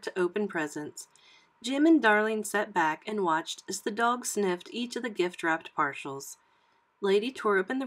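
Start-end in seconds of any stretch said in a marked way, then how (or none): none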